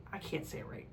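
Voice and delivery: softly muttered, nearly whispered "I can't say it right"; muttered aside. Real, not acted. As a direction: mumble it to yourself, embarrassed. Fumbling the word naivety.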